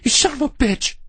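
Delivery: raging outburst; furious curse. A narrator's reading. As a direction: spit out the curse right before the punch. Randy curses before punching him.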